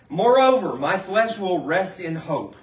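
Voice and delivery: slow, weighty reading of "Fellow Israelites"; scripture reading. Acted. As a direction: read the verses slowly like a proclamation. Reads scripture aloud in sermon.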